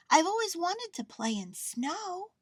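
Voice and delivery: high little bear voice; excited. A narrator's reading. Bear longs to play in snow, excited. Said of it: "he said excitedly".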